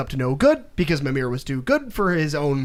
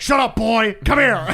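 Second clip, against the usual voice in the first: put-on gruff, louder bark at the boy; father shutting down his son, louder and angry; angry dad; 5 semitones higher, 5 dB louder than usual. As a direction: snap at the kid like an impatient father. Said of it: "so angry".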